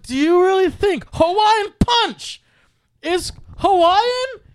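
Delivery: very high, slow, loud incredulous yell. Retold or imitated. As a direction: stretch each word out in exasperation. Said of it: "I started raising my voice".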